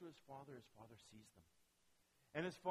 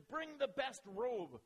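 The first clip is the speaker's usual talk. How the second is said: commanding call; 8 semitones higher, 7 dB louder, slower than usual. Acted. Father commands servants to bring robe.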